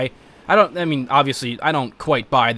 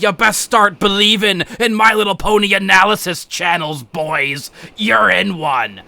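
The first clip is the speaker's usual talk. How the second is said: Barbossa impression; 4 semitones higher, 3 dB louder than usual. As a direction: ham up a pirate captain's warning. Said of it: "fucking Barbossa comes up out of the water".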